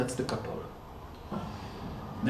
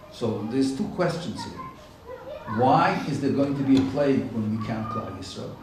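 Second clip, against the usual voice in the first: ordinary talk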